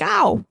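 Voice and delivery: a quick high yelp of pain; mock ow. Shown as a demonstration. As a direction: yelp like you stubbed your toe. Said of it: "be like".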